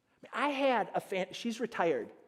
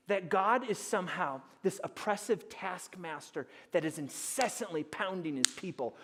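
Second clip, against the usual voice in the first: normal talking voice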